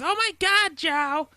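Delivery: high, loud mock mother; mom voice. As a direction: imitate an exclaiming mom, way up high. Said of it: "and then my mom's voice is".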